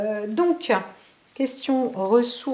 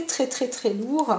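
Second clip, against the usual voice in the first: ordinary talk, nothing marked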